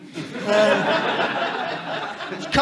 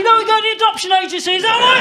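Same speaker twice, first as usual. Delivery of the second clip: higher, fast, laughing threat; mock exasperated dad; 9 semitones higher, 4 dB louder, faster than usual. Dad to nagging toddler, in a laughing mock-exasperated threat. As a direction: joke threat through laughter.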